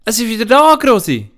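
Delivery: put-on a child overjoyed the gift came back; excited Anna. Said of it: "Die Anna lacht und klatscht".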